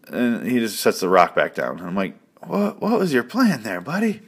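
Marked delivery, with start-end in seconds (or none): 2.4-4.2 much higher, mock-baffled question; incredulous mockery. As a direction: ask it like you can't believe his stupidity. Mocking the character's plan.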